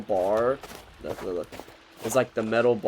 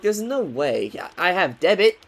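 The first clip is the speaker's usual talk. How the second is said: rising, louder retort that ends in a near shout; indignant protest; 7 semitones higher, 5 dB louder than usual. Retold or imitated. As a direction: push back hard on the last words. Arguing with tow truck men.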